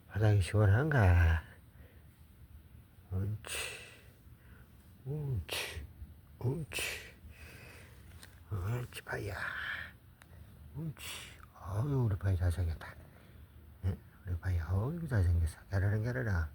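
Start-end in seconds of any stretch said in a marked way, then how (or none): 3.1-11.0 soft praise to a dog. Real, not acted. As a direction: murmur praise softly to a pet. Soft praise while dog eats.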